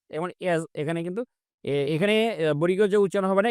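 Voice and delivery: usual speaking voice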